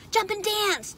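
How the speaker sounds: high rooster voice; high-pitched excited instruction; rooster calling out. A narrator's reading. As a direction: pipe it up like a rooster. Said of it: "said the rooster".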